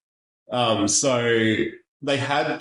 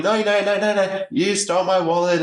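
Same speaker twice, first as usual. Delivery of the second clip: insistent accuser; 9 semitones higher than usual. Retold or imitated. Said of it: "And he's like".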